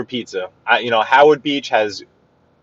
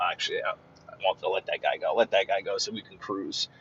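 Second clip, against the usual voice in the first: muttered aside; 4 semitones lower, 13 dB quieter than usual. Real, not acted. Muttering aside while driving.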